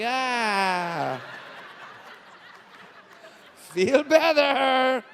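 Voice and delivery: very slow, drawn-out soothing; mock soothing. Husband soothing sick wife, mock tender and slow. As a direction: coo it as you fan her. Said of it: "I'm like".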